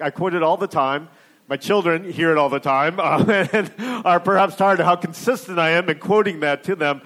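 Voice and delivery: usual speaking voice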